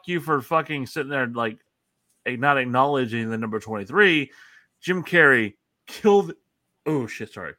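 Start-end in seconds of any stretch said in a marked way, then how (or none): 4.8-6.3 burping the words. Real, not acted.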